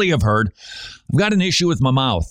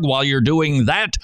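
ordinary talk, nothing marked

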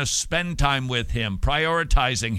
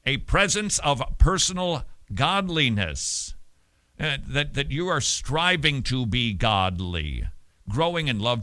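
usual speaking voice

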